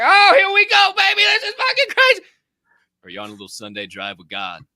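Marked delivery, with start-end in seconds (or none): 0.0-2.2 high, loud screaming frenzy; frantic high-pitched yell; wild thrill-ride whoop. Acted. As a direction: whoop it like a runaway joyride. Acting out wild driving with problems.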